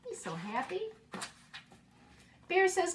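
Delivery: put-on expressive read-aloud for young children; story narration. Teacher reading a picture book to her class, expressive read-aloud. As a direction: read the picture book warmly and slowly, bringing each animal to life.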